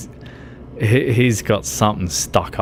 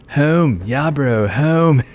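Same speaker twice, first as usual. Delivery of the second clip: higher, drawn-out Aussie "Home" with "bro"; broad Aussie accent; 5 semitones higher, slower than usual. Retold or imitated. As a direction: lay the Australian accent on thick and proud. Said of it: "It's like".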